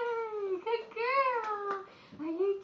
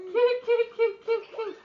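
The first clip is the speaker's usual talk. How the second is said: loud, excited chant of kick; loud cheering; 2 semitones higher, 8 dB louder than usual. Real, not acted.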